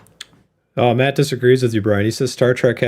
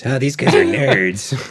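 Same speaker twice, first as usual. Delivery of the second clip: slow, drawn-out mocking voice; mock departing subscriber; 3 semitones higher, slower than usual. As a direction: imitate a put-off viewer, stretching the words. Voicing subscribers who are leaving.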